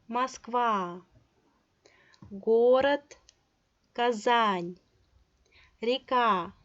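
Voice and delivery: each word from Москва to Россия with its stressed vowel drawn out; teacher's word list, long stressed vowel in Москва, Казань, Россия; lengthened stressed vowels. Shown as a demonstration. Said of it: "and it is lengthened".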